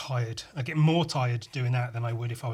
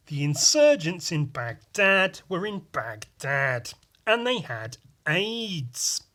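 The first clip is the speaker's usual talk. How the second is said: slow, loud, far mock newsreader; British reporter voice; 4 semitones higher, 4 dB louder, slower than usual. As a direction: read the news like a pompous British reporter. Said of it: "the British reporter voice".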